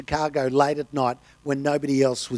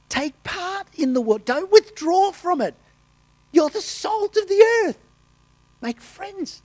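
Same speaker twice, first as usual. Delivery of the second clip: lightly voiced Jesus, higher and stirring; voicing Jesus; 3 dB louder than usual. Preacher voicing Jesus's call to the church, lightly lifted and higher. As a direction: preach the words of Jesus with a lift. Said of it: "Jesus said".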